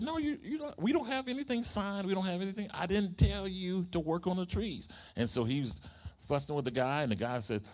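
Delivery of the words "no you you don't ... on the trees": upset protest. Retold or imitated. Preacher denying the contract, high indignant protest. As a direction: plead he never told anyone to work. Acted quarrel with tree worker.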